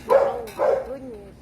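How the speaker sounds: high, loud oh my goodness; loud high delighted gasp; excited gush. Real, not acted. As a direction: gush over the dog. Greeting dog, high loud.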